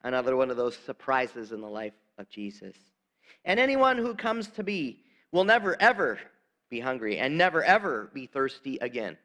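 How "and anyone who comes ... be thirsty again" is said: "never, ever" lifted higher and louder, each word hit; emphatic never ever. Retold or imitated. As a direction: punch "never" and "ever" as a promise. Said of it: "a double emphasis".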